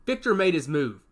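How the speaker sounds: ordinary delivery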